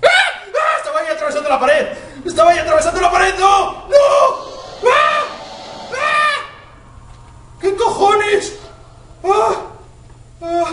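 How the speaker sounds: high frantic yelling; panicked screaming. Real, not acted. Panicked horror game screams.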